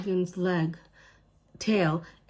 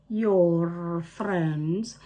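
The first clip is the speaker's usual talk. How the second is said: a scornful, louder sneer; Jolly, mocking; 3 dB louder than usual. A narrator's reading. Jolly mocks Ellie's friends with a louder scornful sneer. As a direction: laugh the word friends off. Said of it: "Jolly laughed".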